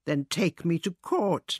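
plain reading voice

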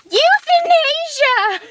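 high, slow, drawn-out exclamation; gleeful announcement. Pretend. Revealing a favorite thing to a friend, gleefully drawn out. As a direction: sing the word out high and stretched.

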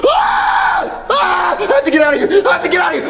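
terrified dad running from his son; panicked screaming. Acted. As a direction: scream and flee in terror. Father flees knife-wielding son.

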